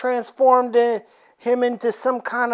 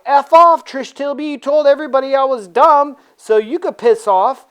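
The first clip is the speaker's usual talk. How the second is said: mock angry retort; 5 semitones higher, 5 dB louder than usual. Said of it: "the Beast is like".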